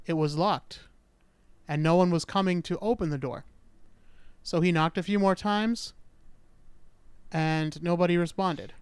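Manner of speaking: ordinary delivery, nothing marked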